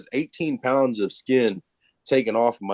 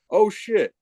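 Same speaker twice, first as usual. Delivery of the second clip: mock dismay; 10 semitones higher than usual. Retold dismay.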